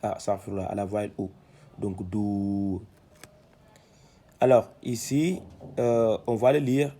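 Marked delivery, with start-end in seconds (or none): 2.1-2.8 "do" held long on a level note; lengthened vowel. Shown as a demonstration. Teacher showing the long Arabic vowel, "do" drawn out. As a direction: stretch out the vowel of "do". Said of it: "sert de dénoncement".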